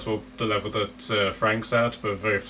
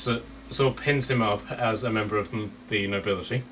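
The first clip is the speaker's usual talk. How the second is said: normal talking voice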